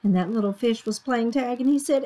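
usual speaking voice

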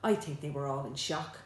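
plain reading voice

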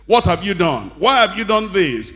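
mock the husband snapping at his wife; angry husband. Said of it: "he misbehaves and says".

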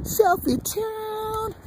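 slow high falsetto announcement; high sing-song. Real, not acted. Vlogger announces the selfie to his viewers.

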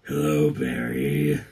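very low, slow villain voice; menacing villain. Pretend. Cosplayer as Reverse Flash greets Barry, menacing villain. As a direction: drop deep and drawl it out menacingly.

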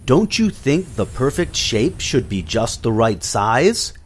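ordinary delivery, nothing marked

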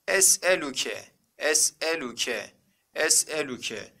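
"Es'eluke" with a small break after Es; model reading. Shown as a demonstration. Teacher reads the word for students, careful model reading. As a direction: sound out "Es'eluke" slowly and carefully. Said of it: "Biz bu kelimeyi nasıl okuduk?".

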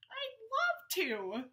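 high happy little-fish voice; Rita accepting. Acted. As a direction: stretch it out sweetly. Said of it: "she said".

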